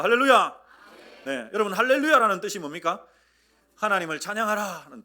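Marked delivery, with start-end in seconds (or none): 0.0-0.6 loud, higher Hallelujah call; rousing pulpit greeting; call-and-response shout. Shown as a demonstration. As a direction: call it out to the congregation. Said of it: "한번 하십시다".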